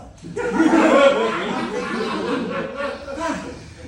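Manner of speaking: loud sustained laugh; real hearty laughter; long laugh. Real, not acted. Laughs about the killer.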